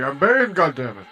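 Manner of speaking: loud mock-indignant announcement; exasperated outburst. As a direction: burst out in comic exasperation. Breaks out to insist on character.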